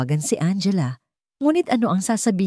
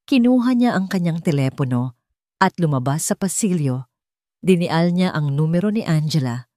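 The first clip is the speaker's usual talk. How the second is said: ordinary delivery, nothing marked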